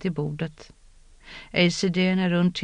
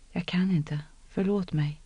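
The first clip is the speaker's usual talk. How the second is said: soft broken apology; 6 dB quieter than usual. A narrator's reading.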